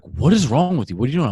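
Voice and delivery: a wife scolding two bickering people; mock scolding. Wife scolding husband and kid, mock exasperated.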